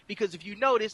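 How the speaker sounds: normal talking voice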